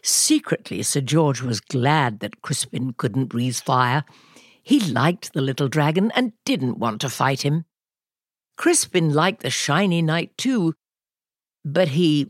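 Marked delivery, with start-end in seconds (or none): none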